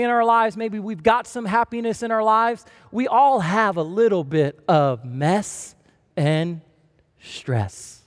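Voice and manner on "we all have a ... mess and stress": slow, low, fading out on stress; dramatic drop. Real, not acted. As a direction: sink the voice down on "stress". Sermon punchline on mess and stress.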